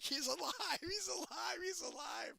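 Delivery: high mock shout of a panicking crowd; hammed-up excited cries; freaking out. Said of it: "everyone's freaking out".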